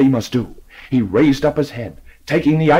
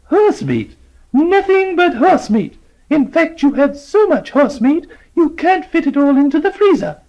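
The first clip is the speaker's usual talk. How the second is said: put-on high, louder old lady scolding; triumphant accusation; 14 semitones higher, 4 dB louder than usual. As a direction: gloat and accuse.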